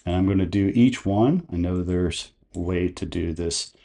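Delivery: normal talking voice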